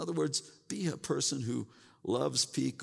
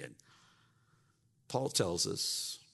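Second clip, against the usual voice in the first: ordinary talk, nothing marked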